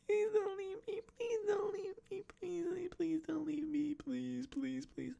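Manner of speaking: very high-pitched mock begging; hammed-up falsetto whine; pleading woman. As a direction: squeal out a frantic plea, over and over. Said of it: "she's just saying the same fucking thing over and over again".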